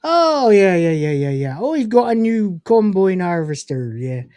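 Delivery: hammed-up Cornish farmer; Cornish accent. Shown as a demonstration. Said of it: "in a Cornish accent".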